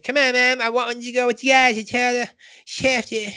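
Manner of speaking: put-on high, whiny kid pleading with his mom; a bratty child begging to tag along; Cartman voice. Cartman begs his mom to let him go, high and whiny. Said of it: "Cartman's like".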